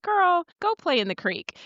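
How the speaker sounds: high, breezy teasing brush-off; sassy dismissal. Retold or imitated. Mentor to mom of a four-year-old, sassy teasing dismissal. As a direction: wave a fond hand at a young mom. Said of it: "I'm like".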